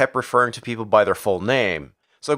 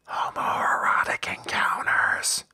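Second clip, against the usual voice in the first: slow, teasing stage whisper; whispered aside; 6 dB quieter, slower than usual. Pretend. Reviewer slips in a joke about the bathroom scene, whispered. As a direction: whisper it slowly like a secret. Whispered joking callback to earlier line.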